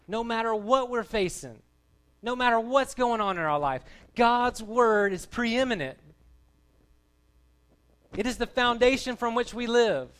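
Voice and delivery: loud, high, emphatic preaching; fervent preaching. Real, not acted. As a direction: drive each phrase home at full voice. Preaches at full force.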